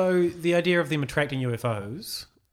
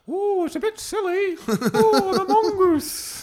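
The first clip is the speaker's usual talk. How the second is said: very high, slow squeaky puppet voice; cartoon kid voice; 7 dB louder, slower than usual. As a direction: ham it up like a puppet show. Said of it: "bad puppet show or something".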